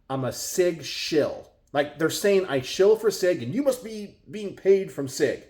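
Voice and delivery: ordinary delivery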